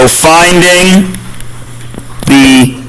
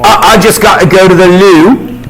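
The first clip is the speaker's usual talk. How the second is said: a playful mock British accent; 4 semitones higher than usual. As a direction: toss it off like a jokey British teacher. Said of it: "I've become British".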